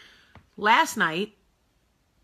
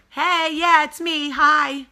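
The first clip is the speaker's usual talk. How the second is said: high, loud, sarcastic hello; mock cheery greeting; 7 semitones higher, 7 dB louder, slower than usual. To the staring couple at the bar, a loud sarcastic hi. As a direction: wave them off with fake brightness. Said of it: "to which I go".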